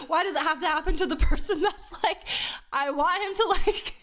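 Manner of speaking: high, pleading mock whine; whiny needy voice. Mocking needy inner voice.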